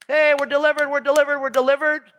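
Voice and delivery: high, loud, happy cheer. Retold or imitated. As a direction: celebrate being set free. People cheering their deliverance.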